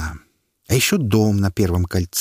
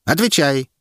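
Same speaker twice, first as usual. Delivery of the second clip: higher, sharp command; stern demand; 6 semitones higher, 3 dB louder than usual. A narrator's reading. Herald demands an answer from the prisoner. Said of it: "потребовал он".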